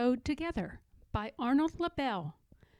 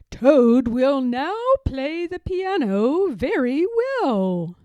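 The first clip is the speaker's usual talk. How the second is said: louder booming announcement; strange announcer voice; 2 semitones higher, 8 dB louder than usual. Acted. The strange voice announces Toad's piano act, booming. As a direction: proclaim it like a stage announcer. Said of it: "said the strange voice".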